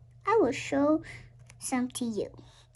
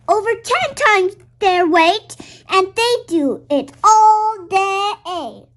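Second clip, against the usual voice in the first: excited; 6 semitones higher, 10 dB louder than usual. Acted. Excited reading about strong ants.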